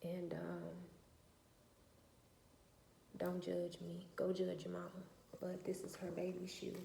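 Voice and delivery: hushed, broken whisper, close to crying; mother recalling her daughter leaving, tearful and whispered; holding back tears. Real, not acted. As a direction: barely get the words out through grief.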